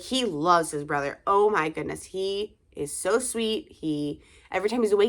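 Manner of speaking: ordinary talk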